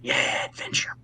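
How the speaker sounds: breathy whispered mock cheer. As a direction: whisper it like a secret joke. Mock-excited aside.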